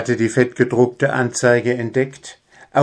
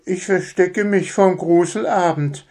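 put-on much higher small boy's voice; murmuring child; 10 semitones higher than usual. Little son hides in the pantry, murmuring. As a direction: confide it like a scared little boy. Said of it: "murmelte Schaufelchen".

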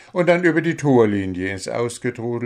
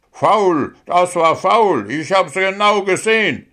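high, loud indignant protest; Paul Kautz, outraged; 9 semitones higher, 5 dB louder than usual. A narrator's reading. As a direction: cry foul like an angry fan. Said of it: "erboste sich Paul Kautz".

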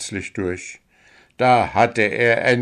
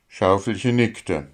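usual speaking voice

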